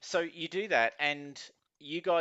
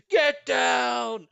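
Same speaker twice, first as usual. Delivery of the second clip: high, loud battle yell; commanding shout; 11 semitones higher, 9 dB louder than usual. Acted. War horse smashing through a crowd, commanding shout. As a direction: bellow it to clear a path.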